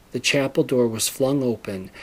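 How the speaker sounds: usual speaking voice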